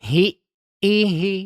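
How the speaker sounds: "Hi, i, hi" with the sound cut off at the glottis before "i"; doubled hamzah. Shown as a demonstration. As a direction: stop the voice sharply, then say "i". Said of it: "hilang suaranya".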